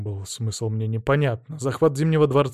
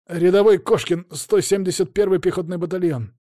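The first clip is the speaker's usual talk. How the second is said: nervous soldier report; 6 semitones higher, 4 dB louder than usual. Acted.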